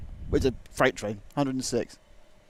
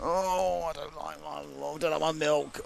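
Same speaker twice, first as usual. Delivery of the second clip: whiny, feeling-ill calf voice; sick calf voice; 5 semitones higher, slower than usual. Pretend. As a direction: moan it like a poorly little calf. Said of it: "Sounds like a slightly unwell calf".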